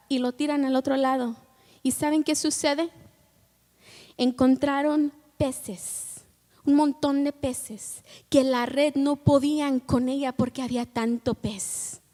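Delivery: normal talking voice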